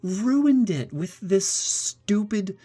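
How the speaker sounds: high, drawn-out exasperated rant; exasperated whine. Real, not acted. Gamer whining high and slow to viewers about a sidekick. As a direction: whine it out slowly, fed up with the game.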